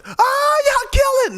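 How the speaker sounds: high, loud, squealing hype-man voice; cheering land voice. Acted. The land cheering a lucky thrower, high and loud. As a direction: shriek it like a crowd egging you on.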